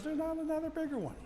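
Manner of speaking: very high-pitched objection; high little turtle voice; protesting turtle. Acted. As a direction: squeak out a puzzled protest.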